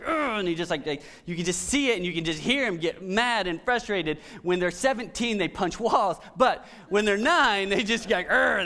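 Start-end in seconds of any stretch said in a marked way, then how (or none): 0.0-0.4 frustrated grunt. Retold or imitated. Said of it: "he's just like". 8.3-8.6 short, louder imitated grunt of a frustrated kid; mock frustrated grunt. Dad imitating his frustrated son's grunt, mock grunt. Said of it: "they just grunt".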